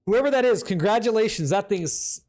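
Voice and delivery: ordinary talk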